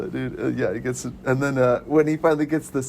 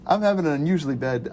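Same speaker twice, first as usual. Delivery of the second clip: ordinary delivery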